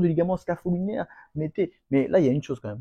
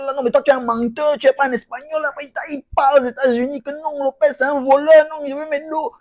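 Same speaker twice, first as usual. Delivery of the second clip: mock outraged fighter; 8 semitones higher, 4 dB louder than usual. Mocking voiced argument about Lopez.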